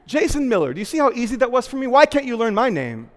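loud, high patronizing taunt; mock shaming, fast and sneering; condescending woman. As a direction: rub it in like a smug scolding lady. Woman mocking him.

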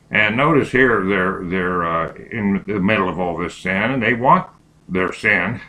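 ordinary talk, nothing marked

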